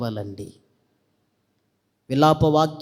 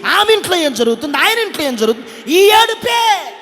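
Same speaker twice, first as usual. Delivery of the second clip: full-force preaching; 10 semitones higher, 7 dB louder than usual. Real, not acted. Preacher rebukes gossiping listeners, high impassioned scolding. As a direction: pile up the rebukes, louder and higher.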